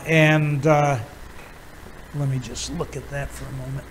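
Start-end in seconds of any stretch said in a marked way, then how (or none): 2.2-3.9 much softer, quick aside; quiet aside. Real, not acted. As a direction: say it low to yourself while checking something. Aside while checking his source.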